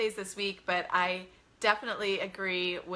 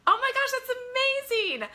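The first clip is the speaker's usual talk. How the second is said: bright, much higher burst of delight; delighted surprise; 5 dB louder than usual. Real, not acted. Thrilled the host's whole family is listening. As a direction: gush with real delight at the news.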